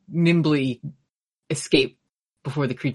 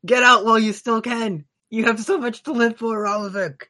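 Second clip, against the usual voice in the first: raised, earnest hero voice; heroic strongman voice; 7 semitones higher than usual. Acted. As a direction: urge a friend to flee. Dwarf strongman speaking to hero.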